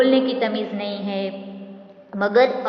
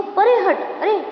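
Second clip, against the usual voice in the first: shooing away; 9 semitones higher than usual. Acted. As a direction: shoo the beggar off sharply. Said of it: "ऐसे धुतकार रहा है".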